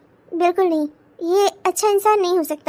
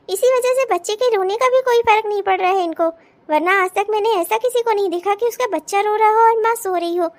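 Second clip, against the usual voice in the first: normal talking voice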